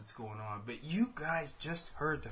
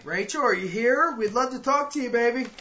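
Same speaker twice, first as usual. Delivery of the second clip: raised, louder voice, summoning someone unseen; calling out; 5 semitones higher, 7 dB louder than usual. Real, not acted. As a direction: call to a spirit in the house. Calling out to a spirit.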